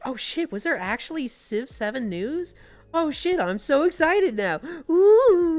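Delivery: rising giddy excitement, high; excited. Retold or imitated. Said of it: "It's like".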